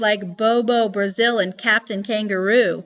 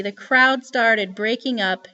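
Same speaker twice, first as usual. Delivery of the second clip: ordinary delivery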